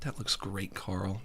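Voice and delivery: very soft, low condescending murmur; Jimmy. Acted. Jimmy humouring Carl about his drawing, barely voiced patronising praise. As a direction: humour him under your breath like a toddler. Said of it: "why did he say it like that?".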